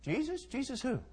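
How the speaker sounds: small, high-pitched, innocent question; feigned ignorance. Acted. Frightened Peter denies knowing Jesus, high and innocent. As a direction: play dumb in a high little voice.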